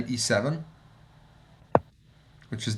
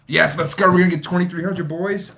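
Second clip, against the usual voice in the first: higher, louder victory call; rally the viewers excitedly; excited cheer; 7 semitones higher, 5 dB louder than usual. Real, not acted. Sees the game is won.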